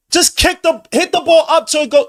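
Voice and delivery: high, loud frustrated shout; real frustrated shout at the screen; yelling at the TV. Real, not acted. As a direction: scream the instruction at the player.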